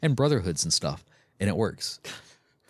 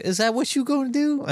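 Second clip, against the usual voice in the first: high put-on taunting voice; mock Duralius. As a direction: taunt him in a squeaky mocking voice. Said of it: "But Duralius is like".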